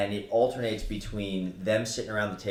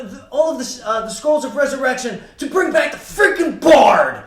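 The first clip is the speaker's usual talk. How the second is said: ordinary talk, nothing marked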